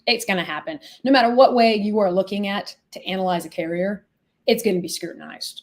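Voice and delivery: ordinary delivery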